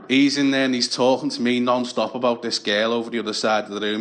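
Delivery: ordinary talk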